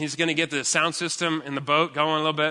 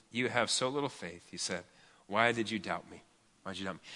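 gentle rebuke; 6 semitones lower, 10 dB quieter than usual. Acted. Jesus gently rebuking Peter.

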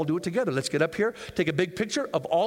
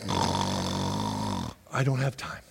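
groaning lazy excuse, very low and slow; deep, slow drawled excuse; 3 dB quieter, much slower than usual. Acted. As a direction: drop very low and drag it out. Voicing believer's excuse.

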